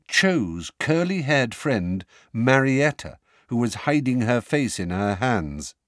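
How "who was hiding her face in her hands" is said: ordinary delivery, nothing marked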